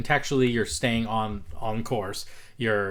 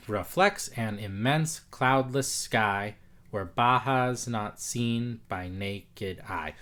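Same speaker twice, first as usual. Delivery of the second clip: slow rhythmic reading; much slower than usual. Shown as a demonstration.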